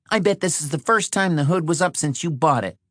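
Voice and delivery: high-pitched woman's character voice; exasperated, pitched well up; accusing Patty. Acted. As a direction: needle him in a high voice. Voices Patty needling Shorty.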